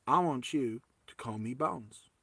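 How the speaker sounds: sit her down gently in a fake American accent; quiet mock Southern American talk. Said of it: "please forgive my American accent".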